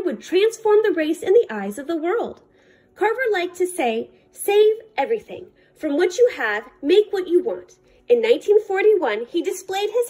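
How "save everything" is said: ordinary delivery, nothing marked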